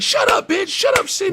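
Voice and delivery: high, shouted abuse; screeching imitation of an angry boyfriend; mock yell. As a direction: scream it at her like a bully. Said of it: "the guy I told you that beats her up".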